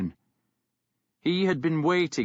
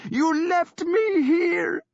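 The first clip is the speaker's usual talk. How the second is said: high, slow, loud sobbing accusation; crying accusation; 5 dB louder, slower than usual. A narrator's reading. Sister accusing her brother through tears of abandoning her. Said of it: "she accused him".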